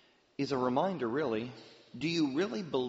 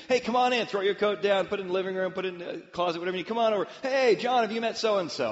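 higher, louder bustling hostess; excited hostess; 7 semitones higher, 7 dB louder, faster than usual. Retold or imitated. Hostess ushering arrivals, higher and louder. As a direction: hurry guests in, cheerful and busy.

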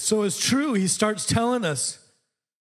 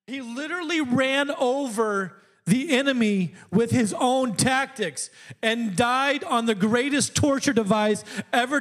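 full-force preaching; 5 semitones higher than usual. Acted. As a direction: preach it to the rafters.

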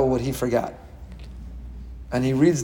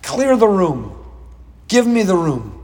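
loud, raised-pitch command falling away; calling out; 5 semitones higher, 4 dB louder, slower than usual. Acted. Yosef orders his attendants out, calling out loudly. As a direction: call out an order to empty the room. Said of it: "So he called out".

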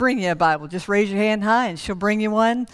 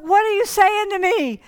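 confused doctor; 10 semitones higher than usual. Retold or imitated.

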